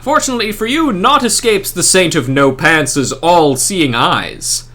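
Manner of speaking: lofty, theatrical holy man addressing a scholar; grand saintly NPC. Acted. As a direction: proclaim it like a pompous festive saint. Saint keeps lecturing.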